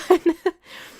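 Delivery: a quick high burst of laughter. Real, not acted. Streamer dodging a cheeky question about Trent, short high laugh.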